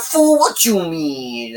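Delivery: stretched-out tough-guy challenge; a hint of a street thug, slow; slow mock gangster. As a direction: gangster puppet doubting her, slow mock voice. Retelling puppet gangster's line.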